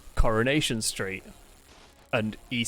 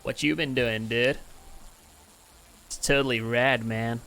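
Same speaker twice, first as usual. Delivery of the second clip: surfer dude American. Shown as a demonstration. Brit showing how outsiders picture Americans, mock surfer-dude American accent. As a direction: put on a cartoon American surfer drawl. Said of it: "American accent is the typical surfer dude".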